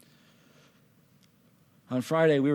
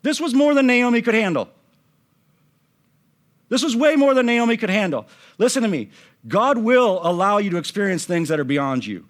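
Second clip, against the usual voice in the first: raised preacher's voice, driving each point home; emphatic preaching; 7 semitones higher, 5 dB louder than usual. Acted. Preacher telling the congregation it was too much for Naomi, emphatic. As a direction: preach it out loud with conviction.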